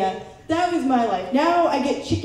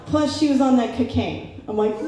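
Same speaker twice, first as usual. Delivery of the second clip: usual speaking voice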